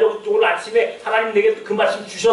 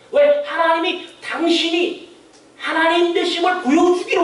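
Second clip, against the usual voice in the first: fervent preaching; 4 semitones higher than usual. Acted. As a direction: drive the point home from the pulpit. Raised, emphatic preaching.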